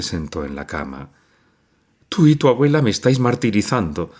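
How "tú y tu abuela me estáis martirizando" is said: higher, fast, tearful outburst; sobbing accusation. A narrator's reading. Mother sobbing at her daughter, wounded. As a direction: blurt the reproach through sobs. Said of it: "sollozó".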